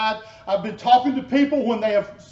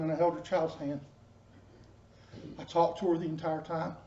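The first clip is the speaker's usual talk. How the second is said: ordinary talk, nothing marked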